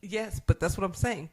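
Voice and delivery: usual speaking voice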